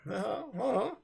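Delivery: ordinary talk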